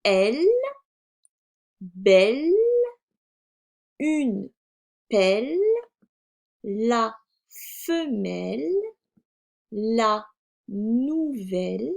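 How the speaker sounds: drawn-out words, long pauses between; reading words aloud. Shown as a demonstration. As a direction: teach beginners, one word at a time. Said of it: "nous allons lire les mots". (lecture de mots, lente et détachée)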